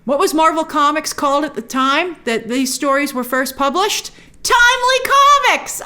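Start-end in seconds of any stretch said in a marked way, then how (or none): 4.4-5.8 high, triumphant exclamation; excited reveal. Real, not acted. Vlogger answering own Marvel trivia question, excited. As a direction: burst out with the big answer.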